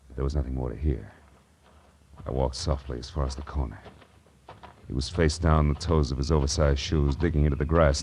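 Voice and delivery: low, subdued private-eye storytelling; grim detective narration. A narrator's reading.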